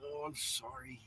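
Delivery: soft sarcastic sorry; mock apology. Man hugging the coat of arms, softly mock-apologetic. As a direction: fake a tender little apology.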